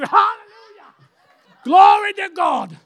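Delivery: high, loud, slow hallelujah shout; shouted praise. Real, not acted. Preacher shouting praise to God before the congregation, very loud and high. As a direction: throw the praise up to the rafters.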